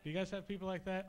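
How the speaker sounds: ordinary talk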